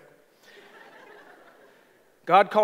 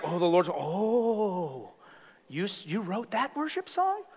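mock awe; 4 dB quieter, slower than usual. Preacher mocking fame in heaven, mock awe. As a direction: gush softly, pretending to be starstruck. Mimicking awe at a worship songwriter.

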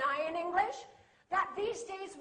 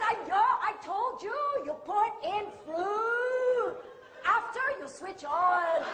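exasperated manager; 3 semitones higher, 6 dB louder than usual. Retold or imitated. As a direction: hammer the instruction home, a touch comic. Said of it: "He said".

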